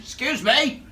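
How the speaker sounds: high, loud "Excuse me!" called out down a corridor; shouted call. Retold or imitated. Calling down an empty jail corridor to a possible intruder, loud shout. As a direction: call out to a stranger who might be there. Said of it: "shouted down into the corridor".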